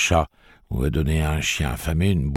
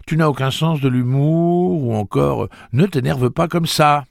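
mocking imitation; 11 semitones higher, 5 dB louder than usual. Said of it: "Et c'était toujours".

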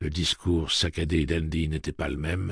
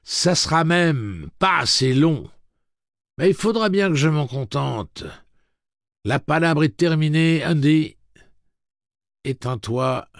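stern command; 11 semitones higher, 10 dB louder than usual. Acted. Eddie orders robot off.

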